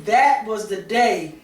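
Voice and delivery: ordinary talk, nothing marked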